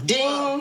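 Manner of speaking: high, sustained "ding"; chiming high ding; imitated phone ring.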